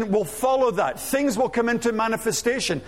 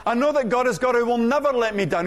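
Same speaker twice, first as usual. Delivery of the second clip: ordinary delivery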